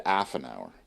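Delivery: "half" said as 'aff, no H at the front; dropped H. Retold or imitated. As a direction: drop the H on "half". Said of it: "But you said".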